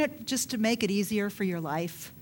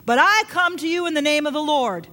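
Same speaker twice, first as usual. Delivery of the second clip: preached shout; 5 semitones higher, 5 dB louder than usual. Retold or imitated. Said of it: "David shouted to Goliath".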